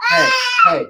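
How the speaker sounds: high sudden hey to get attention; calling out. Real, not acted. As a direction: cut through the noise. Calls kids to sit down.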